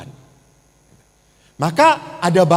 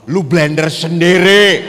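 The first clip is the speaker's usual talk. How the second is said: loud fed-up snap at the husband; fed-up outburst; 9 dB louder than usual. Acted. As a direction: blurt it out loud, done with it. Wife snaps at husband. (bentakan keras istri yang kesal)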